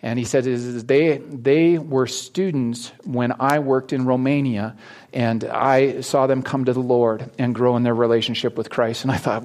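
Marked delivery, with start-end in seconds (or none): none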